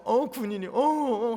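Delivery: retelling his own tears, half-hammed stammering sob; mock crying stammer. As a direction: imitate himself blubbering. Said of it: "그런 눈물 흘리면서".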